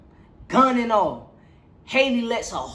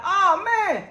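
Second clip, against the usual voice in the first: imitating Wayne; 6 semitones higher than usual. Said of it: "Wayne like".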